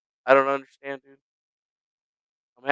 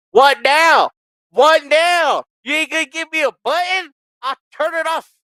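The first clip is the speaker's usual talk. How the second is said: high, loud frustrated shouting at the computer; angry yell; 11 semitones higher, 5 dB louder than usual. Real, not acted. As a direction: yell at the software that won't obey. Yelling at malfunctioning software.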